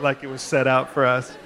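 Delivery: ordinary talk, nothing marked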